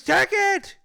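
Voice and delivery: piercingly high barked order; imitating an irate caller's yell; screaming support guy. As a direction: scream the command. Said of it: "guy from DoorDash screamed at me".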